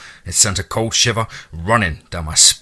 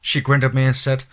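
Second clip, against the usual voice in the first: usual speaking voice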